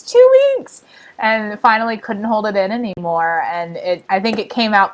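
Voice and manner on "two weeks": high-pitched, loud cry of disbelief; incredulous exclamation. Real, not acted. Camp storyteller stressing how long a child held it, incredulous exclamation.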